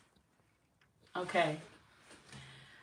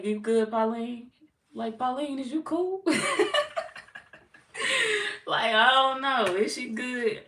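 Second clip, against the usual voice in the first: higher, slower, teasing put-on voice with a giggle; mock concerned friend; 6 semitones higher, 7 dB louder than usual. Mimicking Miss Siren asking about Pauline, teasing put-on voice. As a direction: play the nosy friend asking if Pauline is ok, half laughing. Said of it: "you say".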